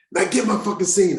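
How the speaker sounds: high-pitched imitated order; retold curt command; demanding director. Director barks after the slap.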